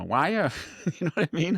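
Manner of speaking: high, lilting Fargo accent; mock a Minnesota Scandinavian lilt. Shown as a demonstration. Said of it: "they have that Fargo accent".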